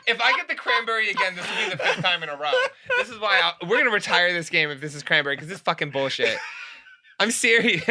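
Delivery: host ranting about losing her own game, laughing; laughing frustration. Real, not acted. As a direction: fake outrage through giggles. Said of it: "now you're mad 'cause you're losing".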